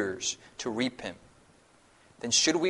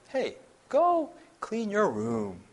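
high, slow, lilting request; musical sing-song tone; 8 semitones higher, slower than usual. Shown as a demonstration. Parent gently asking a child, high slow lilting tone. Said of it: "it's almost like it's a musical tone".

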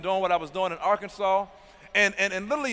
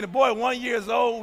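normal talking voice

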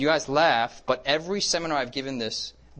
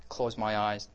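ordinary talk, nothing marked

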